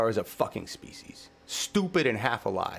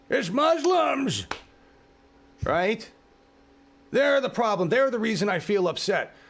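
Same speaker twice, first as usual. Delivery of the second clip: mock bigot voice; 8 semitones higher than usual. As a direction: sneer it like the people you despise. Mocking a bigot's blame.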